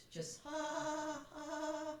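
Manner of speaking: high lip trill on ha; lip trill demo. Shown as a demonstration. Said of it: "trill on it".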